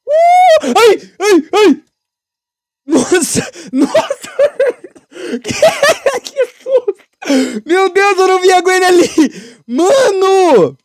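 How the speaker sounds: high frightened shrieks and yelps; real jump scare, scream then shaky laugh; startled screaming. Real, not acted. (gritos de susto agudos)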